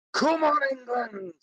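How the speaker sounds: high-pitched England rally cry. Pretend. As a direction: send it up high like a fan. Gives requested rallying cry.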